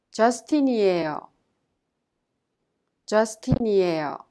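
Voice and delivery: 저스틴이에요 said clearly, each syllable joined; pronunciation drill. Shown as a demonstration. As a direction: model 저스틴이에요 smoothly with a falling end. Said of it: "let's try that again".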